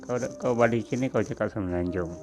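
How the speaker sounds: ordinary delivery, nothing marked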